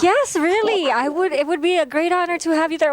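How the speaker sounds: high-pitched retold reassurance; high imitation of the gracious host; imitating reassuring friend. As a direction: insist sweetly, pitched well up. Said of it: "And she's like".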